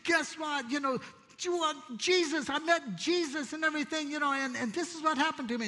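telling his wife he met Jesus, excited. Retold or imitated. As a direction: rush the news to his wife. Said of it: "I found my wife and I go".

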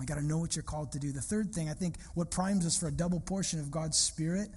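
normal talking voice

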